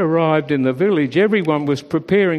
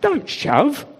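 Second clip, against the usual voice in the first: sheep voice; 9 semitones higher than usual. A narrator's reading. Sheep jostling in doorway.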